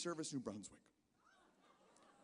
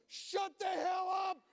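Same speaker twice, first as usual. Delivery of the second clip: loud, high, slow shout; furious yell at an invisible passenger; yelling at nobody; 6 dB louder, slower than usual. Pretend. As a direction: scream it at the empty backseat. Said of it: "look in the empty backseat of your car and go".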